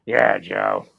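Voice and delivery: low, husky chain-smoker voice; a hint of a raspy smoker; smoker's voice. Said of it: "cigarette smoking".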